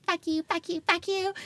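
high-pitched mock ranting; rapid-fire squeaky cursing in imitation of yourself; mouthing off. Mouthing off at the friend who hauls her out, mock defiant. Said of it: "while I'm mouthing off being like".

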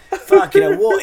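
high, loud incredulous outburst; mock disbelief. Viewer reacting to the show, loudly incredulous. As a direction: exclaim in exaggerated bafflement. Acts out his baffled reaction.

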